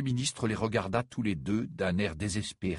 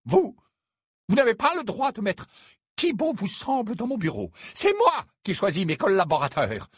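indignant; 10 semitones higher, 4 dB louder than usual. Acted. Premier ministre indigné.

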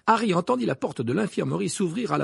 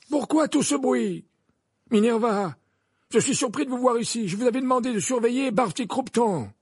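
stern questioning; 9 semitones higher, 4 dB louder than usual. A narrator's reading. Stern Dumbledore questions the quarrelling professor.

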